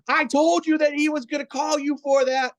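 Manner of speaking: mock coach yelling across the court; retold coach yell. Coach yells at his player after the lift call, imitated high yell. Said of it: "the coach literally yelled as loud as I've ever heard a coach yell".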